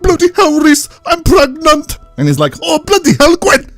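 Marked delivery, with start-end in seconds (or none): 0.0-2.0 high, slow, exaggerated Welsh; out-Welsh everyone; hammed-up Welsh exclamation; mock Welsh accent. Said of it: "out-Welsh each other". 2.6-3.7 out-Welsh him; hammed-up Welsh exclamation; mock Welsh accent. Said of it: "I love the way Reese says that".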